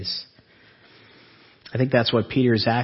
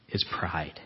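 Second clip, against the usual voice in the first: whispered; 6 semitones lower, 10 dB quieter than usual. Real, not acted. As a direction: whisper "is pride" low. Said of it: "whispered line".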